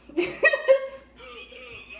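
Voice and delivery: high giggling burst of real laughter; can't hold the prank any longer and cracks up. Real, not acted. Daughter laughing at dad after fooling him on the phone, high giggling laugh.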